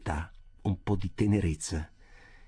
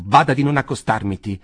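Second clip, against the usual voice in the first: louder angry warning; angry outburst; 8 dB louder than usual. A narrator's reading. Jacob warns Rothschild off, louder and angry. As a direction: push him away with your voice. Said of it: "proruppe Jacob".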